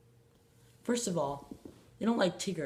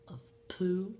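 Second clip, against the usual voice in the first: whisper; 2 semitones higher than usual. Acted. Whispered joke word.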